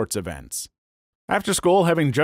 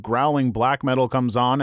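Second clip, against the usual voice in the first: ordinary talk, nothing marked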